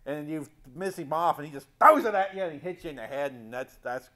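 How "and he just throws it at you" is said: ordinary talk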